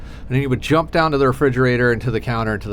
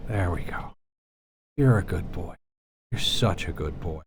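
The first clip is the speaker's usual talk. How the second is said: soft cooing; 5 semitones lower, 7 dB quieter than usual. Real, not acted. Cooing to the cat.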